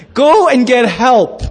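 slow, stretched call for help; mock plea, slowly exaggerated; drawn-out plea. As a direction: draw out each word pointedly. Repeats wife's plea for effect.

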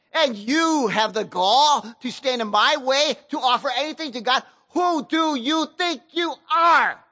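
a proud king exploding at the priests; Uzziah raging at the priests. Acted. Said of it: "and he goes".

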